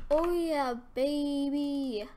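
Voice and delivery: high, loud, stretched-out cheer; gleeful celebration. Real, not acted. Player celebrating new armor, high gleeful crowing. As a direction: crow it with drawn-out delight.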